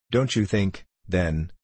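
ordinary delivery

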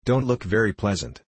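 plain reading voice

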